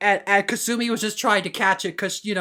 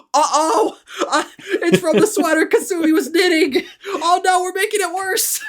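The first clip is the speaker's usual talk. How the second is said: high-pitched, half-laughing fluster; mock panic; 8 semitones higher than usual. Voicing the family fretting over their efforts, mock panic. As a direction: act out a comic panic.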